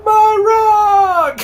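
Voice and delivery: very high, loud distant wail; wailing giant. Acted. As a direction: cry out from afar like a heartbroken giant. Giant cries from cave.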